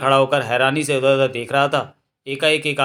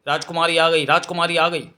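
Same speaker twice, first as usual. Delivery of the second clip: mildly raised cry of excited news; शोर मचाते हुए; 3 semitones higher than usual. A narrator's reading. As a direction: call it out twice, like palace servants spreading news. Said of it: "कर्मचारी शोर करने लगे". (हल्के जोश में पुकारते हुए)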